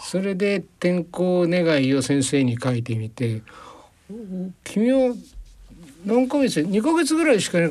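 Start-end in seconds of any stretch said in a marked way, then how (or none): 4.6-7.7 lightly voiced teacher, higher and quick at the end; a hint of the old teacher asking; teacher's voice. Teacher quoted in story. (先生の口調を軽く真似て)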